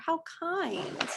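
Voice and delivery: softly touched, hushed; tender. Real, not acted. Teacher touched by a kind scene in the book, soft. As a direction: breathe it out fondly.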